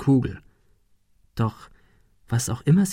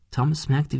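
ordinary delivery

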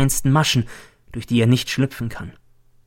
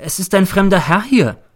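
higher-pitched anxious landlady; worried; 7 semitones higher, 4 dB louder than usual. A narrator's reading. Landlady warns her lodger of a stranger, worried. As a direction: announce it with audible concern. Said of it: "empfängt mich meine Hausfrau besorgt".

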